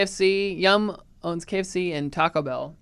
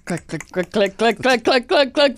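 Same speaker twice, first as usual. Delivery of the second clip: roller coaster impression; 4 semitones higher than usual. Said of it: "terrible impression of a roller coaster".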